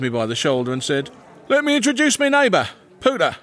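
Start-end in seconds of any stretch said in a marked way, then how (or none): 1.5-3.4 high-pitched, hearty introduction; hearty Farmerson. A narrator's reading. Farmerson introduces his neighbour to a sheriff, high and hearty. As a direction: pipe up as a pushy acquaintance.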